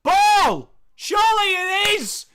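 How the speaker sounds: high, loud commentator's shout; excited play-by-play. Acted. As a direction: shout the big moment at full pitch.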